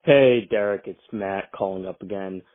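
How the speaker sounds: usual speaking voice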